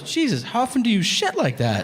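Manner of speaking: high-pitched, incredulous imitation of a wife; appalled wife. Wife appalled at his bathroom habits.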